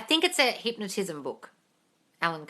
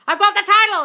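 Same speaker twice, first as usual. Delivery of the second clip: much higher and louder, triumphant; excited announcement; 13 semitones higher, 12 dB louder than usual. Retold or imitated. Author rings his publisher with the title, thrilled. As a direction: blurt out the good news. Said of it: "He's like".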